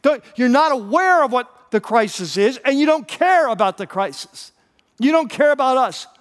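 high-pitched, exasperated complaint; panicked irritation, pitched well up; irritated. Acted. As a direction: accuse your leader in rising irritation. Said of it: "you can hear the irritation in their voice".

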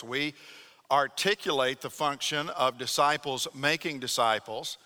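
ordinary talk, nothing marked

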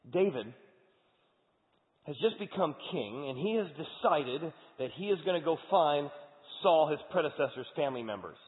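high, loud preaching retelling the story; fired-up storytelling. Acted. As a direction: build the drama like a pulpit storyteller. Preacher retells Old Testament story.

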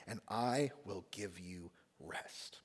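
whispered, slow and hushed; soft whispered promise. Acted. Preacher reading Jesus inviting the weary, slow whisper. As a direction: let the promise fall to a whisper.

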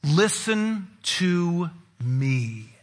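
very slow, emphatic parental warning; stern parent. Retold or imitated. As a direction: lay down the law like a parent. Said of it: "when your parents would say".

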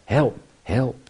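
mock-urgent plea; cry for help. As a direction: call out in short bursts. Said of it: "I need help".

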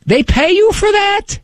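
high, disbelieving question in grandpa's voice; Papo. Acted. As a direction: play an astonished old-timer. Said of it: "I could only picture Papo".

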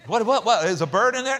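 high, loud puzzled guessing; confused charades guess. Pretend. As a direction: guess wildly at the gestures. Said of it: "can you imagine Zechariah?".

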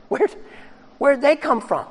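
high, startled where'd; mock astonishment. Onlookers seeing the risen church, high startled question. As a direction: play the horrified onlookers. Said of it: "The people look in horror".

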